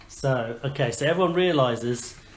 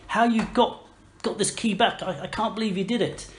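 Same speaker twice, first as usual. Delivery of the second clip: higher-pitched pleading curiosity; eager townsfolk; 6 semitones higher than usual. Acted. Townsfolk beg adventurers for the story, high and eager. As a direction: coax the heroes for their tale, voice pitched up. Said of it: "everyone gathers around in the".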